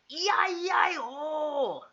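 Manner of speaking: put-on loud, high, drawn-out Japanese-accent shout; mock Japanese accent. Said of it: "say that name in a Japanese accent".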